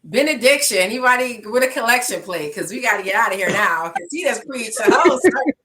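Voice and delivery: loud, fast playful call to wrap up; joking call for benediction. Real, not acted. Jokingly calling for the collection plate after his testimony, loud and fast. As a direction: hype up the room like the service is over.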